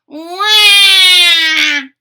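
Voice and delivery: put-on loud high baby wail. A narrator's reading. Newborn Polly bursts out wailing, loud baby cry. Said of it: "cried Polly".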